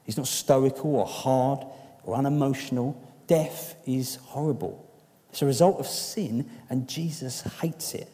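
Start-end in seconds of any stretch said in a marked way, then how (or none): none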